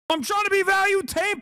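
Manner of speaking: jumps way up high, teasing; mocking high repeat. Repeats his own line mockingly.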